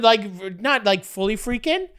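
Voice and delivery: ordinary delivery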